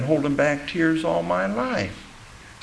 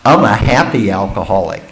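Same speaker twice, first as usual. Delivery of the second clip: mocking quote; 6 semitones lower, 7 dB louder than usual. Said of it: "this one I love".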